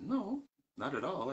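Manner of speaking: high, drawn-out retold denial; protesting innocence, acted out; emphatic denial. Retold denial to the upset student.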